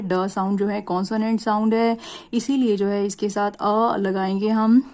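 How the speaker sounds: ordinary delivery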